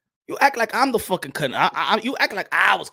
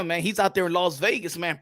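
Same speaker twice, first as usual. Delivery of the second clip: ordinary talk, nothing marked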